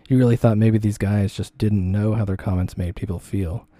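usual speaking voice

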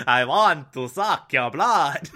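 hammy, slow Transylvanian vampire; mock vampire with thick Transylvanian accent. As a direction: do a comic Dracula-style Transylvanian accent. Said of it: "that's more Transylvanian".

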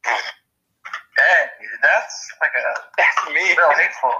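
a voice put through something; odd filtered voice. Acted. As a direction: talk through a cheap filter. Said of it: "makes your voice sound weirder".

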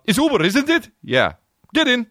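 high, put-on voices for the driver and rider; acted Uber pickup. Comic acts out a rider and Uber driver meeting, high and hammed-up. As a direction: play both sides of a silly pickup.

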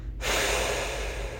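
long audible breath out; slow exhale sigh. Shown as a demonstration. Coach showing a slow breath out. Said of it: "slow down your breathing".

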